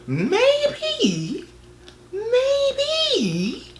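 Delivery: very high, drawn-out falsetto; exaggerated falsetto suggestion; comic squeaky maybe. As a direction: sing out the word in a tiny high squeak. Comic realisation about the narrator.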